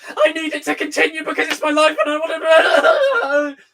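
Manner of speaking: high, loud whining with a laugh in it; mock a fan whining to keep it going; whiny mock fan. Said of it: "It's not just".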